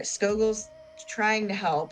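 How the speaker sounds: normal talking voice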